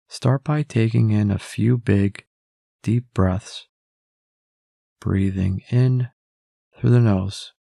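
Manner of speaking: ordinary talk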